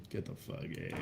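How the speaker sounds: whispered scoff under the breath; hushed, dismissive, voice dropped to a breath; muttered aside. Real, not acted. Streamer scoffing quietly at viewers, unvoiced aside.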